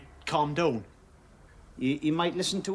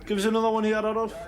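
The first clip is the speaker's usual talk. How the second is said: calling out; 6 semitones higher, 5 dB louder, faster than usual. Acted. Calling to barman in bar.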